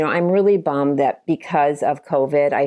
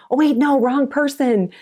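flustered correction; 10 semitones higher than usual. Retold or imitated. Guest correcting her speech mid-sentence, high and flustered. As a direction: snap back at your own mistake. Catching her mix-up on stage.

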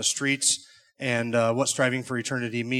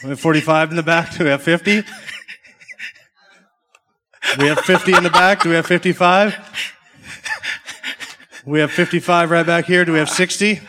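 louder, laughing auctioneer call; 4 semitones higher, 8 dB louder than usual. Acted. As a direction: call the bid out over the crowd. Runs a charity auction.

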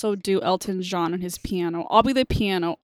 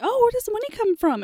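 mock innocent; 13 semitones higher, faster than usual. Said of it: "And I was like".

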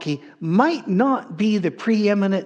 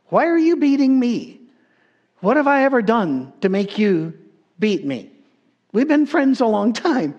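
donkey pleading; 6 semitones higher than usual. Acted. Preacher voicing the donkey asking Balaam, high and hurt. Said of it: "have the donkey turn around and ask Balaam".

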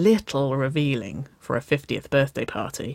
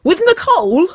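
very high, loud excited shriek; screamed; 11 dB louder than usual. A narrator's reading. Sister guessing who the date is, screaming. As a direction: scream the question in disbelief. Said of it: "Wynona screamed".

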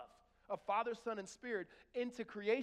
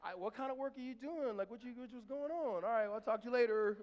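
higher-pitched, rambling phone patter; chatty husband; 6 semitones higher, 6 dB louder than usual. Acted. Husband trying to get wife talking, chatty. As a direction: pester playfully, then wrap up loudly.

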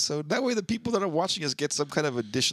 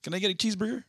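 high-pitched casual stoner pitch; mock skater-dude voice; stoner skater kid; 7 semitones higher, faster than usual. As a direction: play a young stoner making an offer. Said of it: "stoner skater kid".

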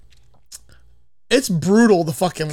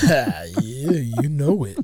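predatory menace; 4 semitones lower, much slower than usual. Pretend. Plays along with creepy bit.

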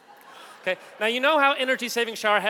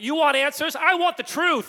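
shouting, higher and louder, Jessup blowing up; yelling back at Kaffee in the final scene, higher and louder; movie shout; 8 semitones higher, 7 dB louder than usual. Retold or imitated. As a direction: belt it like the courtroom climax. Acting out the A Few Good Men clash.

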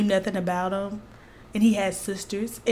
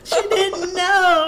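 laugh-crying, slowed right down; tearful laughter through the words; 7 semitones higher, much slower than usual. Real, not acted.

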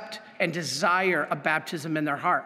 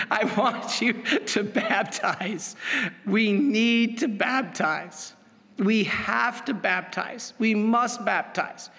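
each baptize pressed home slowly and firmly; slow, pressing preacher's insistence on baptize; slow insistent exhortation; 2 semitones higher, slower than usual. Real, not acted. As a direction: drive every baptize in, slowly building urgency. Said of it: "I'm trying to scare you".

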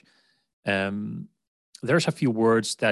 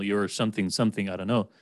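usual speaking voice

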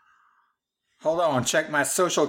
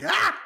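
sudden high frightened yelp; startled yelp. Retold or imitated. Twitchy startled by a greeting, high yelp. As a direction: jump out of your skin. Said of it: "and jump behind something".

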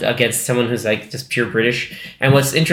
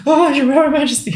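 much higher, breathless "your Royal Majesty"; flustered curtsy greeting; 13 semitones higher than usual. Retold or imitated. Wife suddenly meeting the King at the door, in a high flustered gush. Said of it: "She's like".

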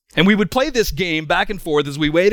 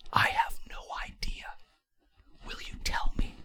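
soft unvoiced whisper, leaning in close; whispered confession; 13 dB quieter than usual. The hardened anarchist whispers his confession to his friend, hushed. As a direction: hush it like a secret you're ashamed to admit.

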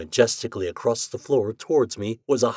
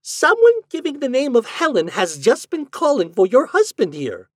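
high, loud simpering party guest; 7 dB louder than usual. Acted. Party guest announces a caller to the wife, simpering. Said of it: "Miss Hinkle simpered".

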